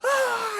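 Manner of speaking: high, hammed-up pained shriek; mock scream on fire. Host imitating a burning boat worker's scream. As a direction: yelp like a man on fire.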